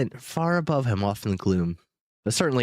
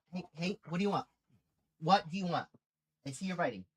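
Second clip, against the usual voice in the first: high, soft coaxing question; baby talk to dog; 5 semitones higher, 10 dB quieter, faster than usual. Pretend. Owner asks her dog what it wants, high and soft. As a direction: coax the dog to answer.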